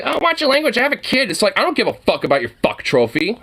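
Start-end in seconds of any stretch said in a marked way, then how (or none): 0.0-1.3 very high, whiny mocking voice; mocking stranger. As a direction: parody the prim mother, pitched way up.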